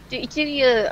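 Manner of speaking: ordinary delivery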